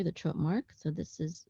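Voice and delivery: ordinary talk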